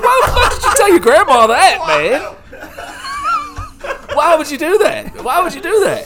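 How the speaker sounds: high, loud, laughing disbelief; incredulous yell. Retold or imitated. Boy yelling at his friend who told grandma, laughing. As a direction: yell it at your friend while cracking up. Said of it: "I'm like".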